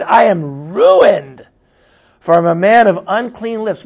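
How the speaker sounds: loud, stretched-out lament; anguished cry. A narrator's reading. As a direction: cry out the confession at high volume. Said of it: "I cried".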